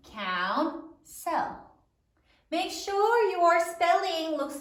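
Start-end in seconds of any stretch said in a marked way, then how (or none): 0.1-1.6 "Counsel" as coun... sell, in two beats; syllable by syllable. Shown as a demonstration. Teacher finishes dictating counsel, syllable by syllable. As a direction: draw "Counsel" apart with a full E in the second syllable. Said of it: "say it as you write it".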